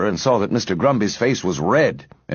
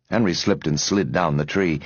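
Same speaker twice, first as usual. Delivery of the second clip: ordinary delivery, nothing marked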